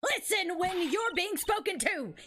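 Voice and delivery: put-on scolding old woman with an amateur British accent; Old Bag. Guard telling youths to listen, scolding mock-British old woman. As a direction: snap at youngsters in a rough British accent. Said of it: "giving her a British accent".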